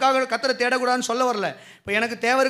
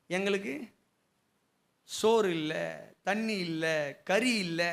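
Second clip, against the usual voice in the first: low, soft mock lament; imitated whining; 5 semitones lower, 10 dB quieter than usual. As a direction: list each lack in a quiet, mock-tearful moan. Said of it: "இதே தான் அழுதுட்டு இருந்தானுங்க". (அழுது புலம்புவது போல மெதுவான குரலில்)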